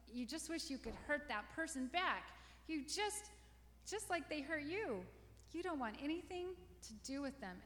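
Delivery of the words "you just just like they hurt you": ordinary delivery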